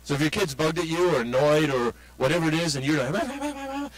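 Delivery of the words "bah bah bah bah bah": high, quiet rapid babble; mock nagging. Parent lecturing an annoyed kid, mock nagging.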